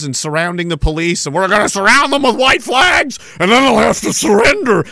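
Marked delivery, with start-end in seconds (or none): 1.3-4.8 high, loud mocking shout; mock battle cry. Mocking protesters' plan to surround police, shouting in mock zeal. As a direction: holler a ridiculous war plan, pitched way up.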